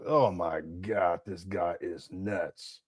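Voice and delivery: slow, soft, stunned aside; quiet, slow disbelief under the breath. Real, not acted. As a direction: let it fall out of you, slowly. Reacting to Umar clip, disbelief.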